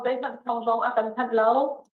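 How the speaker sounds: normal talking voice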